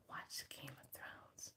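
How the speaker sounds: slow hushed unvoiced order; whisper. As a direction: whisper it slowly like a secret. Boss whispers command.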